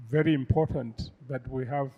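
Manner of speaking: normal talking voice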